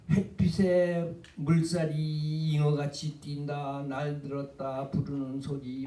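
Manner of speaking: slow, flat, even reading with no emphasis; a dull, unmarked recital of the lines; flat plain reading. Shown as a demonstration. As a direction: read it flatly, like an announcer. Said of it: "그냥 밋밋하게 읽어가지고는 안 되고".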